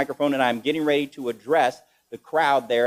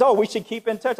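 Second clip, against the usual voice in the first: woman's voice; 8 semitones higher than usual. Retold or imitated. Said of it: "She says".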